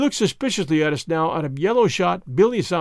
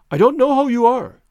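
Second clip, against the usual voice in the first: higher and louder, blurted in alarm; alarmed outburst, pitched up and loud; startled cry; 6 semitones higher, 6 dB louder than usual. A narrator's reading. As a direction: cry it out, shaken. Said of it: "he cried".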